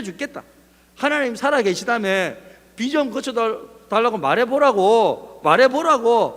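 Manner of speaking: loud, high taunting dare; mocking challenge. Classmate daring the believer to stop the rain, mocking. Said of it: "하나님을 막 조롱하는 그 소리에".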